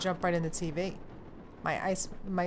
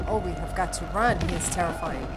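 ordinary talk, nothing marked

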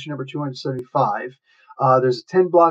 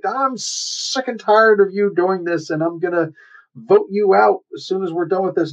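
mock fed-up anger; 6 semitones higher than usual. Drunk hero telling off his leader, mock fed-up anger. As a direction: act out an exasperated telling-off. Acted quarrel, Hercules fed up.

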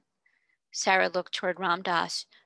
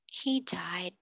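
very quietly; 5 dB quieter than usual. Acted. Sarah telling of her father's death, quietly. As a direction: let the words drop quietly. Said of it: "said Sarah very quietly".

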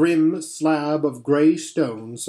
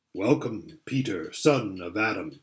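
lower, deep and rich regal lion; 6 semitones lower, 4 dB quieter than usual. A narrator's reading. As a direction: welcome them like a calm, kingly lion. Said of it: "His voice was deep and rich".

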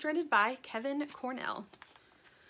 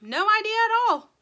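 bright cheerful; 12 semitones higher, 10 dB louder than usual. Acted. Dirt high loud.

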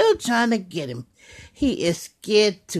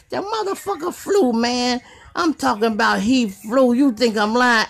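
ordinary talk, nothing marked

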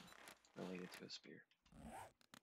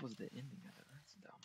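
whisper; 4 semitones higher, 7 dB quieter than usual. Real, not acted. Exasperated whisper at game ending.

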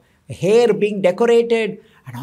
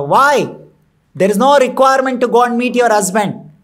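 higher, louder, quick protest; acted objection; 5 semitones higher, 6 dB louder than usual. A narrator's reading. King objects to Rambha meeting her husband, insistent. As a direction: challenge the reason for going.

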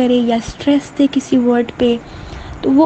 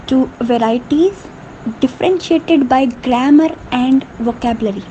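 very slow, word by word, for note-taking; teacher dictating at a crawl; slow dictation; much slower than usual. Shown as a demonstration. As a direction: spell it out slowly so students can write. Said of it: "teacher dictating the definition".

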